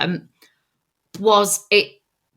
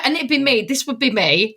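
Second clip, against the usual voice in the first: normal talking voice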